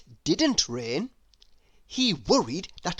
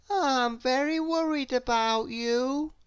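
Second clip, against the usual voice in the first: high, fretting little voice; anxious high character voice; fretful Mister Worry; 7 semitones higher than usual. Acted. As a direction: worry at him, pitched up.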